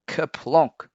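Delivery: a big clattering bang; loud kerplonk. A narrator's reading. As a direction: boom it out like a crash. Spoon falls loudly.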